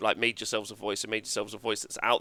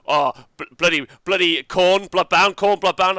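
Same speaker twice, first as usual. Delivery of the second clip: louder, higher hammed-up podcaster gushing; mock hype; 6 semitones higher, 8 dB louder than usual. Mocking Aussie podcasters gushing over Khorne, in a loud, higher hammed-up voice. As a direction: gush over the army like an overexcited fan.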